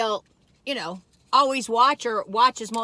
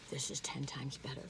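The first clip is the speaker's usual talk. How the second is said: whispering; 8 semitones lower, 17 dB quieter than usual. Real, not acted. Savouring toast, mouth full.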